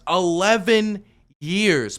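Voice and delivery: "Eleven years" dragged out slowly, each word leaned on; slow emphasis. Real, not acted. Hammering the eleven-year delay with slow emphasis.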